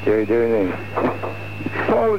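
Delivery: usual speaking voice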